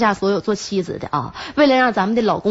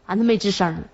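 ordinary talk, nothing marked

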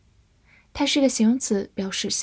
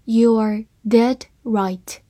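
slow, careful read of each word; 3 dB louder, much slower than usual. Shown as a demonstration. Teacher reading slowly to learners, slow and careful. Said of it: "好，慢慢来读".

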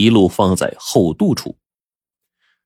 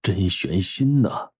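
gentle; slower than usual. A narrator's reading. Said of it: "温和地说".

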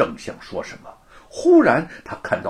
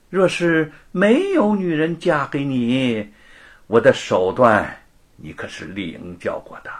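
higher, louder cunning bargaining; scheming wager; 4 semitones higher, 4 dB louder than usual. Acted. Luo bets Wu for the book, sly and smug. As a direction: tempt him into the bet.

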